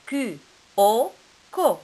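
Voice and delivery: "Q-O-ko" spelled out loud and slow; spelled syllable, loud. Shown as a demonstration. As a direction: blend the letters into "ko" for the class. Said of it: "spelling syllable for children".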